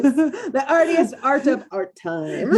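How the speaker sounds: slow and laughing. Real, not acted. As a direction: laugh through the line. Joking, laughing.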